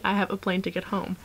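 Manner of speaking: ordinary talk, nothing marked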